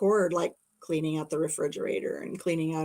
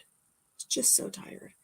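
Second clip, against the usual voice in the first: exhausted whisper; 10 dB quieter, slower than usual. Real, not acted. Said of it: "I was so tired".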